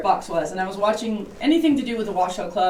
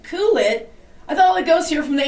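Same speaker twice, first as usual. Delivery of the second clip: incredulous; 6 semitones higher, 4 dB louder than usual. Retold or imitated. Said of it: "And I thought".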